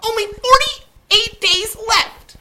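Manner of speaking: very high, loud, slow elf call; hurrying elf. A narrator's reading. Elf rushing Sophie along, high and loud. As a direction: call it back over your shoulder, high. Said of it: "Mister Pudding called".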